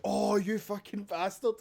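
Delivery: high, drawn-out mock outrage; hammed-up high-pitched exasperated cursing; retold outburst. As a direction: imitate a mate cursing you, high and slow. Friend's outburst in retold anecdote.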